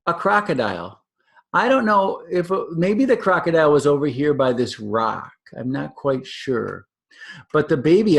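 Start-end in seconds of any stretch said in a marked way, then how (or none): none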